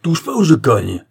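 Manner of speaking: loud, high, threatening yell; furious shout. A narrator's reading. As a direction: shout a threat to hit him. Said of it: "cria Léopold, furibond". (cri furibond, fort et aigu)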